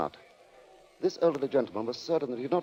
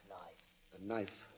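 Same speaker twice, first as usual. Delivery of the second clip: faint, far-off echo of the words; echoing whisper; 5 semitones lower, 13 dB quieter, slower than usual. Acted. The spectators' words echo in Ellen's mind, faint and distant. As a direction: let it fade like a memory.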